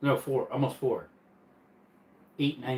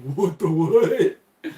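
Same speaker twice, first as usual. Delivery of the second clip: mock confusion; 3 semitones higher, 7 dB louder, much slower than usual. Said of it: "I'm like".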